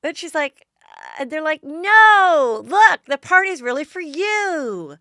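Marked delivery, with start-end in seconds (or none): 1.6-5.0 loud, high, eager reassurance; brightly insisting it's really for her; insistent excited quote. Retold or imitated. Friends insisting to the bride the party is hers, loud and high.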